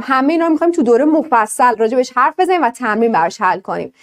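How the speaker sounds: ordinary delivery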